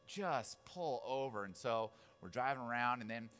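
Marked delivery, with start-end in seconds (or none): 0.1-1.4 slow, drawn-out plea; mildly fed-up passenger; weary exasperation. Retold or imitated. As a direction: stretch each word in exasperation. Retold plea to lost grandma.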